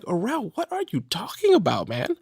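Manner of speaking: high, excited self pep talk; rising, delighted disbelief; amazed self-talk. Retold or imitated. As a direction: wake yourself up to how lucky you are. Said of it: "Then I was like".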